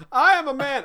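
high, loud hammed-up proclamation; mock manly boast. Dad answering the kid with a mock proclamation. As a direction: declare it like a pompous he-man.

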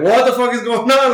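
high, loud mock yell of panic; retold yelling. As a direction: yell it like a thug caught off guard.

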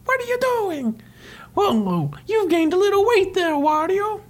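very high cartoon Mario; Mario's voice. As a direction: tease in a squeaky hero voice. Mario voiced in comic retelling.

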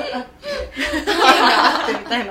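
bursting into loud laughter mid-sentence; drawn-out words dissolving into a big laugh. Real, not acted. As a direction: crack up while saying it. Laughing while restating the idea.

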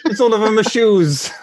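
put-on angry Italian accent. Italian with dog poo on shiny shoes, comic outrage. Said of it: "Italian stepped in dog poo".

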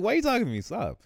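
high, loud comic protest; exaggerated, high-pitched leave-me-alone; standoffish rebuff. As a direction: squeal a mock-offended brush-off. Acts standoffish man rebuffing people.

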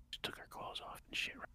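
hushed whisper. Real, not acted. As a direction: keep it to a whisper. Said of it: "I have to whisper".

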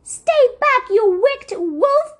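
high, loud shout at the wolf. A narrator's reading. Luna shouting at the wolf, high loud shout. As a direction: yell the warning high and loud. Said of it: "a shout".

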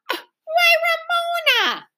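high, gasping shock. A narrator's reading. Big sister reproaches Ramona, shocked. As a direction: start high with shock, then drop on the name. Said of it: "Beezus was shocked".

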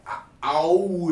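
spelled out "A-, owl" syllable by syllable, laughing; phonetic spelling. Retold or imitated. Mocking a phonetic script, sounding out "A-, owl". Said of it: "helping him by spelling it like".

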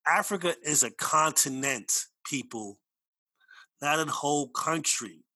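slow, weighty "Africa is a continent", stress on continent; emphatic correction. Real, not acted. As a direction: lay it down slowly like a firm correction. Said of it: "Let me say it again".

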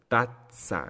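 tazza split by a held stop before "tsa": tat-tsa; pause on double Z. Shown as a demonstration. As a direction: hold a little pause inside tazza. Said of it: "Entonces hago una pausa".

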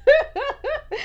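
laughing through the words; cackling as she says it; giddy laughing sing-song. Real, not acted. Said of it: "'Cause I'm a dagger".

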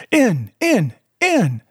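high-pitched shouted order, each word set apart; urgent shout. A narrator's reading. Blind man orders his men into the house, urgent shout. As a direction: shout it high, urging them on. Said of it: "he shouted".

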